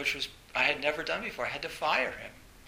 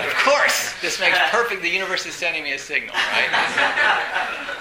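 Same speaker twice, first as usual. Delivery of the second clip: loud, laughing amusement; giggling disbelief at the sign; laughing aloud; 4 semitones higher, 10 dB louder than usual. Real, not acted. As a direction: laugh it out at the joke. Laughing at cosmic coincidence.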